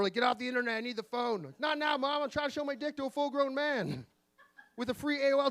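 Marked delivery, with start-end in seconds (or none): 0.1-1.4 mom calling out, high and raised; imitating mom. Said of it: "when your mom bangs on the floor". 1.6-4.0 high-pitched protest to mom; whine-yell back at mom, mid-task; teen yelling back. Acted. Acted teenager yelling back at mom.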